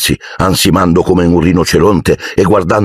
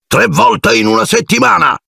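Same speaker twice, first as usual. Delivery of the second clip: high, blustering shout of an angry uncle; thundering uncle. Acted. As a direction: boom it across the table. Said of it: "tuonò". (zio che tuona, voce alta e furiosa)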